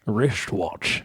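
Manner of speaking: low Scottish "rishtwatch"; Sean Connery. Shown as a demonstration. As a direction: copy the Scottish star, slushing the s. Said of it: "a Sean Connery accent".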